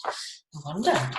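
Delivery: whisper. Shown as a demonstration. Teacher whispers wondering aloud.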